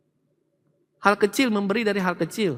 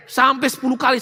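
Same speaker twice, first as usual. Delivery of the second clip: ordinary delivery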